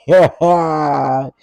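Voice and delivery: sudden loud laugh; loud burst of laughter. Real, not acted. Laughs at own mistake.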